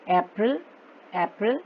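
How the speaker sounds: normal talking voice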